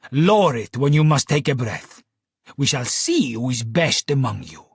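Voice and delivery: put-on a stage magician's Italian accent, drawn out; Italian showman accent. Magician leads audience challenge.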